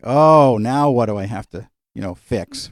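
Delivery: a sudden loud exasperated Oh, then a weary sigh of a question; mock dread at yet another family crisis. She hears the crying family on the phone and answers with exasperated dread. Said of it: "And it's like".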